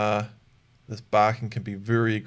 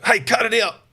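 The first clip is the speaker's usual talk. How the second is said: high, loud "Hey, cut it out"; sudden stern command to the dog; sharp correction; 10 semitones higher, 5 dB louder than usual. Shown as a demonstration. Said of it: "good dog training can look very schizophrenic".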